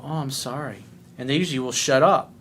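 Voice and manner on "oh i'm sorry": much softer, sheepish imitation; meek apology. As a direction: play the chastened client, voice dropped. Client backs down after rebuke.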